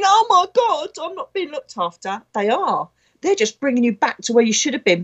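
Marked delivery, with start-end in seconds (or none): 0.0-2.2 a high, mock-panicked cry that drops off quickly. A medium mocking a human who feels abandoned, mock panic. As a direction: play the fretful human, pitched high then trailing. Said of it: "as a human thinking".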